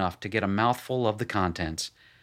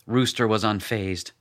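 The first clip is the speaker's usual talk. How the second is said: ordinary delivery, nothing marked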